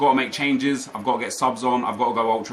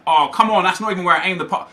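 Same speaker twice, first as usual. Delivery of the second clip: loud, higher cry of real frustration; frustrated outburst; 8 semitones higher, 6 dB louder than usual. Real, not acted. Gamer yelling at his own misplaced pass, loud and frustrated. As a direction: blurt it out angry at the pass. Botched pass in live game.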